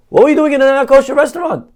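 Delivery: high, loud, fast scolding; outraged rabbi. Acted. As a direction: demand an explanation in mock outrage.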